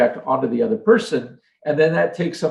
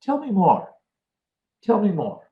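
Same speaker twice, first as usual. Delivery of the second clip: inviting tone; 8 semitones higher than usual. Shown as a demonstration. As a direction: invite them to keep talking. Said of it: "It's a simple phrase".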